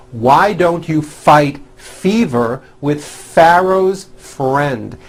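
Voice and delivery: slow practice read, lingering on every F; stretched F practice. Shown as a demonstration. Teacher reading a practice paragraph, slow stretched F sounds. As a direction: read it slowly, each F clearly drawn out. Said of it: "Let's work on this paragraph".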